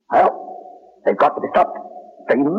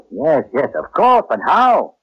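agitated; 5 dB louder than usual. Acted. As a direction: cry out for an answer, stretching the last words. Agitated search for a way.

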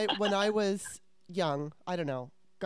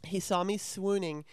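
ordinary delivery

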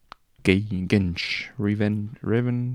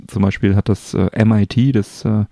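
ordinary talk

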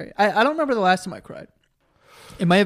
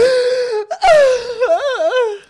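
high laugh; 7 dB louder than usual. Real, not acted. Laughing about crying hard.

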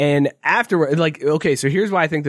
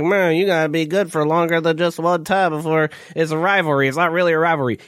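imitating Scott.

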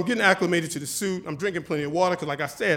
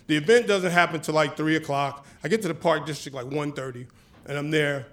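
ordinary talk, nothing marked

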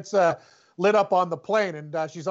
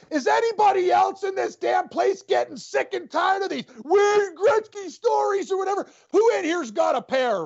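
retold drunken shout; 10 semitones higher than usual. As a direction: yell it at the bar crowd. Drunk girlfriend shouting in the bar.

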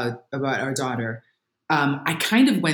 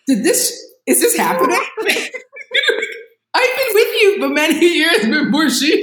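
high-pitched, louder, laughing disbelief; incredulous outrage; 12 semitones higher, 3 dB louder than usual. Retold or imitated. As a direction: act out exasperated disbelief, pitched way up. Acting outrage at her husband.

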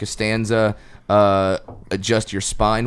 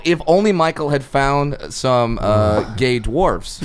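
ordinary talk, nothing marked

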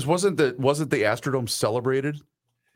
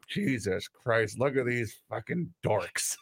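muttered disbelief; 7 dB quieter, slower than usual. Retold or imitated. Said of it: "He looks at me and goes".

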